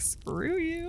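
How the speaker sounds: high, snippy brush-off; dismissive sass. Retold or imitated. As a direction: toss it off like a bratty teen. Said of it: "It's like".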